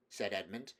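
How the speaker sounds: usual speaking voice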